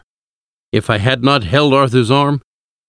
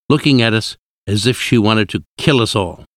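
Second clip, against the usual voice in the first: ordinary delivery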